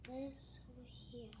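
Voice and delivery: soft hushed whisper. Real, not acted. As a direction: whisper it quietly. Unvoiced whisper.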